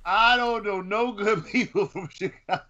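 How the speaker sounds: high voice breaking into real laughter; amused, high-pitched, laughing delivery; laughing while talking. Real, not acted. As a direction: crack up halfway through the sentence. Joking, cracks up while answering.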